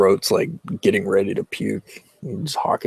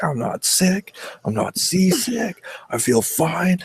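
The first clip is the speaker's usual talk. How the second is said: higher, insistent denial; protesting; 10 semitones higher, slower than usual. Retold or imitated. Son insists to dad he isn't seasick, higher insistent denial. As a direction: pitch it up like you swear you're fine.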